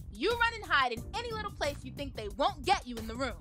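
normal talking voice

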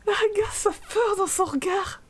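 high, squealing laughter through the words; laughing hard. Real, not acted. Player cracking up at a frightened character, helpless laughter. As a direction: talk through a real laughing fit.

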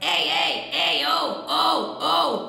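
twangy hey darkening to oh; distorted calls, growing darker; darkening distortion. Shown as a demonstration. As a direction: start pirate-like, darken the colour. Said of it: "I would darken it up".

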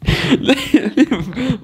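real laugh, high and bright. Real, not acted. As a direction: burst of high-pitched laughter. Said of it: "My older self is absolutely rinsing".

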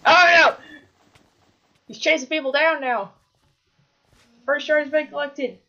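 erschrockener lauter Ausruf; being chased. Real, not acted. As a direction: panisch auf der Flucht. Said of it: "I think I'm being chased".

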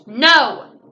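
loud sharp no; flower girl shouting no; shouted. A narrator's reading. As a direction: shout a refusal. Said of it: "shouted Bo".

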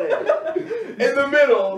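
high, slow, laughing build-up; mock conspiracy revelation. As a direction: draw it out like a crazed theorist's big reveal. Mock conspiracy theorist bit.

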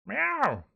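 high, loud startled meow; cat yowl. Acted. Cat struck by falling hedgehog.

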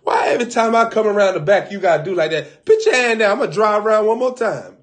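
high, loud exasperated yelling; mock yelling. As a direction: yell it out at the driver. Acting out a loud quarrel scene.

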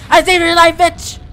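loud shout. Real, not acted. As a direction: shout it the second time. Repeat shouted.

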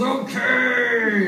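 high, put-on drawn-out okay; silly voiced okay. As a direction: answer in a dopey character voice. [name] answering in put-on voice.

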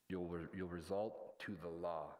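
ordinary talk

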